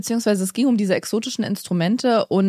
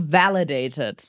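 official stamp voice; 6 semitones lower, much slower than usual. Pretend. Mock official seal stamping.

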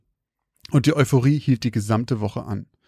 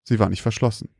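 ordinary delivery

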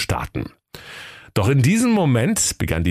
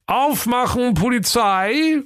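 high, drawn-out call through a door; hammed-up inspector's yell; police shout; slower than usual. A narrator's reading. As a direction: call out like police raiding a house. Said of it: "gegen die Türe wummerte und rief".

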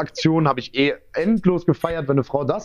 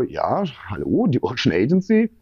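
hesitant phone answer; 2 semitones lower than usual. Retold or imitated. Agency boss picking up a morning call, hesitant.

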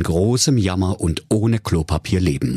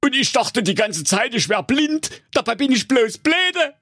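much higher put-on suitor voice, calling up; Enrico. Enrico calling up to the tower, high put-on voice. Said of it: "sagte der Enrico".

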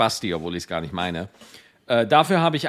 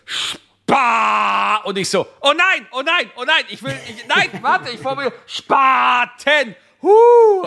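higher, loud hammed-up panic over a near slip; mock panic at almost saying a slur; 9 semitones higher, 5 dB louder, slower than usual. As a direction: shout "oh nein" in pretend alarm and catch yourself. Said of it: "so als ob ich sagen würde".

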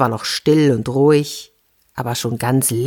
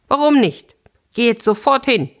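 high-pitched, imperious wife; acted indignant demand; 8 semitones higher than usual. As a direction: order the husband off impatiently. Frau drängt den widerstrebenden Mann. (gespielt herrisch und empört)

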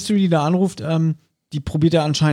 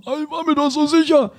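high, put-on whiny voice; stammering Bob; 11 semitones higher than usual. Mocking Bob caught out by the woman, high and whiny. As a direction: whimper it out, caught out and embarrassed. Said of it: "Er stammelt rum".

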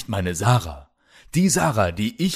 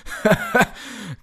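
high mocking laugh; villain laugh; 12 semitones higher than usual. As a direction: laugh him off cruelly. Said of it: "Kralle lachte".

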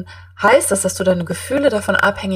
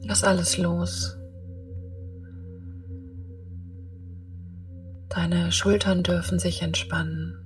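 guided meditation; 9 dB quieter than usual. Acted.

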